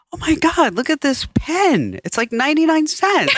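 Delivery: high, gushing amazement; excited gushing. Retold or imitated. In the dollar store, gushing high over a cheap pen. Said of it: "I'm going like".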